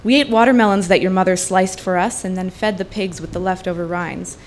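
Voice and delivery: normal talking voice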